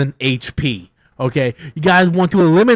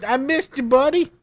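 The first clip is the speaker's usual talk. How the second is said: put-on much higher, sweet baby-talk greeting; cutesy reunion voice; 10 semitones higher, slower than usual. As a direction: coo it like greeting a long-lost pet. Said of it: "I'll be like".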